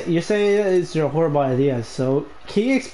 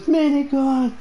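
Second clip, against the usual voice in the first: higher, drawn-out mock cry; mimicked panic callout; 5 semitones higher, much slower than usual. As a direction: echo a teammate's callout high and stretched. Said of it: "all I heard was".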